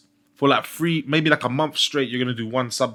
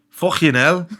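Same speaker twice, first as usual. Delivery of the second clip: higher, throaty Scouse k; Scouse accent; 4 semitones higher, 4 dB louder, slower than usual. Shown as a demonstration. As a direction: put on a Scouse curse. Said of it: "Do a Scouse accent".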